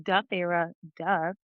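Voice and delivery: low-pitched dopey sarcasm; playful, deadpan ridicule; mocking duh. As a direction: drop the pitch to tease the obvious. Mocking the heroine's obvious question.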